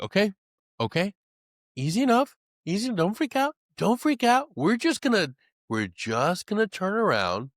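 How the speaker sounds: high, tense voice talking himself down; squeaky near-panic, trying to stay calm; lost in the woods, fighting panic in a high strained voice; panicky self-talk. Retold or imitated.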